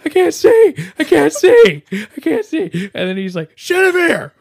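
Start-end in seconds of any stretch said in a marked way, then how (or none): 0.0-2.9 high, loud hammed-up wail; frantic mock shouting; panicked yelling. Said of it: "he starts yelling". 3.6-4.3 loud, high mock bellow; hammed-up sideline shouting; exasperated coach yell. Coach yelling at downed player.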